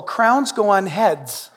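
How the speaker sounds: usual speaking voice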